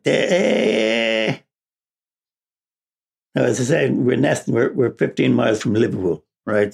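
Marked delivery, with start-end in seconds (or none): 0.0-1.4 Scouse shout. Retold or imitated. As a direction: bark it like a Liverpool kid. Schoolboy greeting, Scouse.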